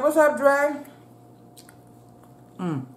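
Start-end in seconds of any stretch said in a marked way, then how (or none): 0.0-0.8 bright phone greeting. Retold or imitated.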